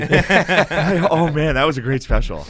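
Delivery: high, loud mock-enthusiastic flattery; fake gushing praise. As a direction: gush insincerely, mocking your own two-faced act. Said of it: "such a fake pussy".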